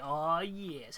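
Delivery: ordinary talk, nothing marked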